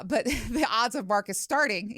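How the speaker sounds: ordinary talk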